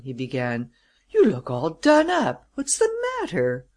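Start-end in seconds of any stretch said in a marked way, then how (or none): 1.1-3.7 high, rising astonished questioning. A narrator's reading. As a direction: ask in startled concern, pitch leaping up. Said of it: "stared at him in astonishment".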